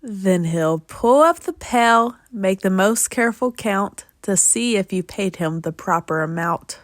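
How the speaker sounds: usual speaking voice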